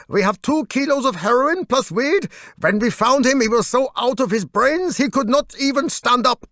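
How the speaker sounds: a hint of a courteous cop explaining; policeman. Officer explaining the drug bust to the singer, lightly voiced.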